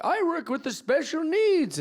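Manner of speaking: high-pitched put-on elder; old-person voice. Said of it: "someday I'll be old".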